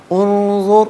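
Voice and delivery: उन्जुर with the नून hummed through the nose, high and loud; nasal ikhfa demo. Shown as a demonstration. Teacher models ikhfa on उन्जुर, nasal hum. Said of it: "उन्जुर में नून साकिन है".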